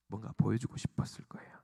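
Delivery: hushed repeat. Real, not acted. Preacher repeating the disciples' secret wish, hushed. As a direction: drop to a confiding whisper.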